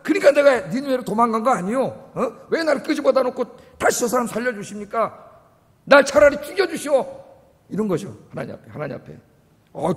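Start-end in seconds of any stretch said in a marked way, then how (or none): 0.0-7.2 indignant complaint to God, loud and high; 화내는 요나. Retold or imitated. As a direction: argue back in angry, raised-voice protest. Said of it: "화를 내면". (화를 내며 높고 큰 소리로 따지듯)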